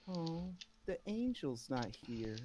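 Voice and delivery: a lady sighing that the angel is missing; Missus Primewater. Acted. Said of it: "and she says".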